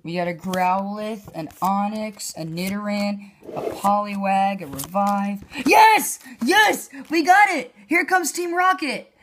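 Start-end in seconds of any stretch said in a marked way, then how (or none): 5.7-9.1 high, loud shrieks of joy; excited shouting. Real, not acted. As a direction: cheer like you just won big. Pulls the secret rare card.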